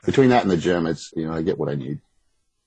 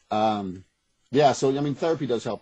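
usual speaking voice

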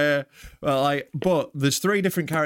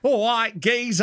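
Cockney; 6 semitones higher than usual. Acted. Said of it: "like Cockney".

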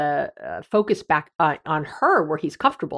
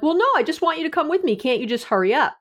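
higher, hurried imitation of pleading; protesting; 8 semitones higher, faster than usual. Imagined partner pushing back on options, mildly protesting. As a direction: lightly act out an impatient protest.

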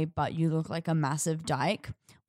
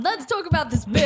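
laughing; 7 semitones higher, 4 dB louder than usual. Pretend. Parent voice, laughing.

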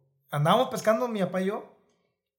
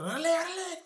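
cocky dismissive brush-off, pitched high; a mocking imitation of a cocky young guy; arrogant; 11 semitones higher than usual. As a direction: toss it off like an arrogant smuggler. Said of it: "bien prepotente el chavo".

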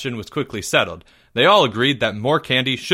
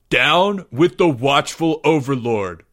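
slow, booming rallying cry; bellow; 3 semitones higher, 3 dB louder, slower than usual. A narrator's reading. Greg rallying against the overlord, slow bellow. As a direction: bellow it slowly like a protest chant. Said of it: "bellowed Greg".

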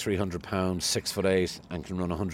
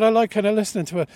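much higher, louder mock-crestfallen cabbie; disappointed cab driver; 13 semitones higher, 8 dB louder, faster than usual. Cabbie hearing the host slagged, high hurt voice. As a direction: pitch it up high and let him sound let down. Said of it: "He said".

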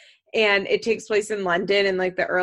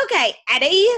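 higher, louder Cockney imitation; Cockney grandfather; 6 semitones higher, 6 dB louder, faster than usual. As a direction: imitate an audiobook grandfather's Cockney, lifted at the end. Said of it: "in the grandfather's voice being like".

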